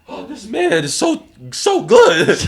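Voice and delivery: high, loud burst of awe; amazed raving. Retold or imitated. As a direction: act out someone blown away, voice leaping up. Retold amazed reaction of host.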